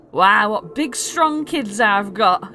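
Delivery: exaggerated fatherly wonder; proud dad. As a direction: humour your kids with big praise. Father humours his boasting children.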